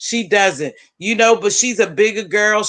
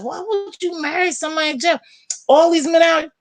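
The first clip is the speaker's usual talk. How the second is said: incredulous high question; 9 semitones higher, faster than usual. Retold or imitated. To her friend marrying a jailed man, high incredulous disbelief. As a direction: ask it like you can't believe her. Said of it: "I said".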